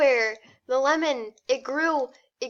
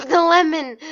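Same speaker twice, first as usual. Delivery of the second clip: ordinary talk, nothing marked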